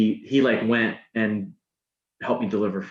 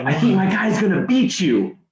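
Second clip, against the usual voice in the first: higher, louder cocky taunt; imitating a smug sales manager's jab; 8 semitones higher, 4 dB louder than usual. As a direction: rub it in before walking off. Manager taunting after screaming.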